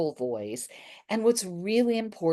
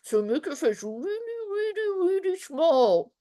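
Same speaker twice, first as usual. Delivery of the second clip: pinched high voice, words squeezed tight; squeezed small voice; 11 semitones higher than usual. Shown as a demonstration. Drama teacher showing a tiny face, squeezed high voice. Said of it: "make your face as small as you can".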